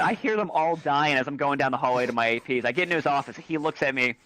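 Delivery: usual speaking voice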